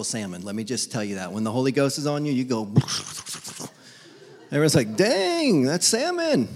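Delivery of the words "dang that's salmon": high, impressed exclaim; mock-amazed onlookers. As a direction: gasp it out in awe. Everyone impressed by believer.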